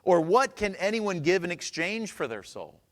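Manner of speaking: emphatic scripture reading; preaching scripture. Acted.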